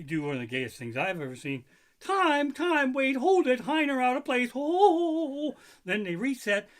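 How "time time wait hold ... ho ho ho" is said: high, loud squealing mock player; hammed-up fussy player. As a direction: squeal for a timeout like a fussy diva. Voicing players calling time.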